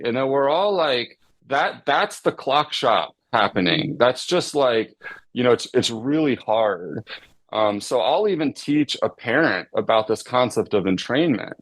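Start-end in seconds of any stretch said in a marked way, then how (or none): none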